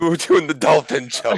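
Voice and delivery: high, loud playful repeat; gleeful mock echo. Gleefully echoing the other player's plan.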